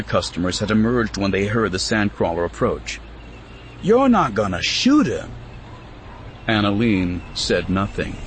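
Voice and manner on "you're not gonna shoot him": higher alarmed question; a friend alarmed at the rifle; worried question. Acted. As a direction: voice rises in disbelief. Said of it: "Lily said".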